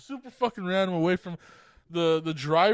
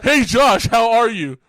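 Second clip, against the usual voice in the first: mock greeting; 5 semitones higher, 11 dB louder, slower than usual.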